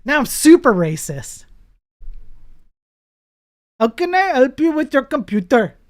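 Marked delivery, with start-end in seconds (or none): 3.8-5.8 a hammed-up Indian accent, higher and louder; a mock Indian tech-support caller's accent. Streamer mocking his Indian friend from chat with a high, loud Indian accent. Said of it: "Try the Indian accent".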